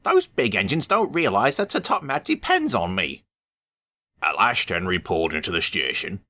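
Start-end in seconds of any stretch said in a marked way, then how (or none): 0.0-3.2 high-pitched cross grumble; complain, fed up with waiting; a little engine grumbling crossly; impatient grumble. Acted. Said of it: "he grumbled impatiently".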